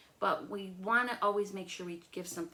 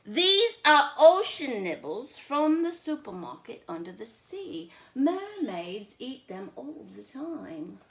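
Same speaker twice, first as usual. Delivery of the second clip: louder, slow, mock-grand boy; Charlie selling the trick; 5 semitones higher, 10 dB louder, slower than usual. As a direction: pitch it like a tall tale. Charlie voiced in story.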